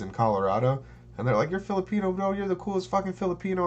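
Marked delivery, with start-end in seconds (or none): 1.5-3.7 high, fast hyped bro. Retold or imitated. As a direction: hype him up like a party bro. Vietnamese dudes quoted.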